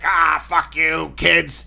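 put-on high, slow, cranky old-woman voice; curmudgeonly old lady. Eighty-year-old Italian lady cursing at kids, cranky and drawn-out. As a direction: play a crotchety old Italian aunt. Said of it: "like, curmudgeon-y Italian lady".

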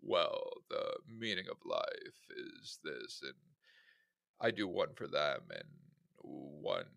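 low, slow, deadpan monotone; serious monotone voice. Retold or imitated. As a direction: drone it out flat and serious. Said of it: "talks in, like, serious monotone voice".

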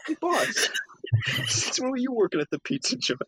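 high, cheery, dim voice; goofy henchman caught ordering pizza; clueless henchman. Retold or imitated. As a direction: sound surprised and oblivious. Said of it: "Luca, get off the phone".

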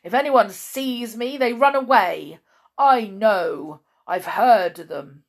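loud, insistent bitterness; bitter outburst. A narrator's reading. Blind man insisting that everyone flees him, loud and bitter. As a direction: drive each phrase out loudly.

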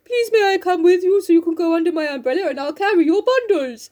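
high comic suitor voice; Mister Bear. Acted. Bear offering to carry Jo's bundles, put-on voice. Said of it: "He's like".

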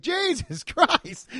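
slow, high, laughing Jesus Christ; laughing disbelief. Retold or imitated. Retold disbelief.

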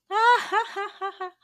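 high cackling laugh at a foolish friend; mocking chicken laugh. Chickens laughing at the eagle, a high mocking cackle.

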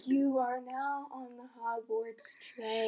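soft, slow meditation murmur; mock guided meditation. Recreating the shifting meditation, a soft slow guide voice. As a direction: lull them onto the train, softly. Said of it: "the little guided meditation".

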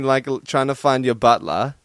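normal talking voice

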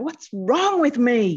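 exasperated self-questioning. Acted. Therapist voicing an anxious client, exasperated. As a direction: ask it in mock despair, slow and loud.

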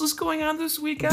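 high, salesy ad read; ad pitch voice. Acted. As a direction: pitch it like a commercial. Mock ad pitch, "Is this an ad?.

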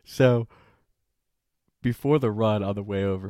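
usual speaking voice

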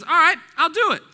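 high, loud taking up of a challenge; game acceptance. Acted. Acts out answer to a challenge.

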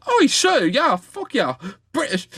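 high-pitched mock London accent. Imagined Londoner accepting the police lift, hammed-up London accent. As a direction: put on a cartoonish London voice, high. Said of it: "this guy's from London, so he's probably like".

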